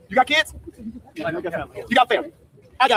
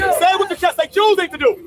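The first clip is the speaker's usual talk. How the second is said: rally call; 7 semitones higher than usual. Real, not acted. Leader urging the crowd to shout back, rousing. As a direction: pump up the crowd.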